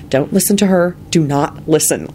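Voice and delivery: ordinary delivery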